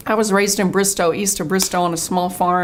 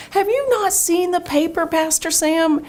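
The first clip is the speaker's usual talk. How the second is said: much higher, pleading disbelief; disbelieving plea; 10 semitones higher than usual. Retold or imitated. Asking Pastor Sam about the paper, high and disbelieving. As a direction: she can't believe he missed the ad.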